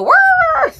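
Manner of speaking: high, loud silly dog howl; mock dog howl. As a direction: yelp it like a pack of dogs. Dogs barking in made-up scene.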